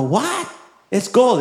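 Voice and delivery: high, shocked disbelief. Retold or imitated. Husband hears the gold watch is worth seventy dollars, shocked. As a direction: jump up in pitch, stunned.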